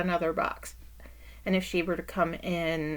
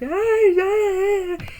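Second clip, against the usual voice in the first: high, loud imitated wails; mock wailing; 13 semitones higher, 10 dB louder, much slower than usual. Daughter imitating her mother's wailing over a broken TV, high and loud. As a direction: wail like mom over the dead TV. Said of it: "She is literally freaking out".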